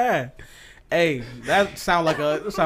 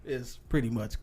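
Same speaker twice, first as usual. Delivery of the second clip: ordinary delivery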